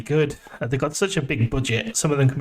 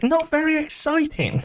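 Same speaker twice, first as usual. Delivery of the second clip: high, slow incredulous echo; scandalised, drawn-out high pitch; mock outrage; 12 semitones higher, slower than usual. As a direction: repeat it back in exaggerated disbelief. Echoes co-host's words in mock outrage.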